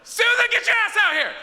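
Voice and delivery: high, loud yell across the lot; yelling for Susan. Retold or imitated. Dealer shouting for his partner, loud high yell. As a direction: bellow her name from the car. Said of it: "in the pen and goes".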